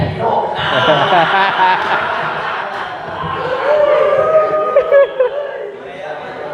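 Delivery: high long laugh. Real, not acted. Teacher laughing during the lesson.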